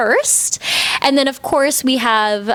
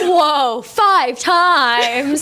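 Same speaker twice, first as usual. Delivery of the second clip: higher, mock-startled reading; mimicking a first-time reader; 5 semitones higher, much slower than usual. Co-host teasing host's first read of the summary, mock surprise. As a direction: exaggerate surprise as if just discovering the words. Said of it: "like it was, like, your first time reading it".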